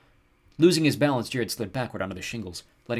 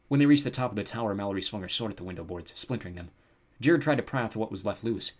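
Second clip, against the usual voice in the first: plain reading voice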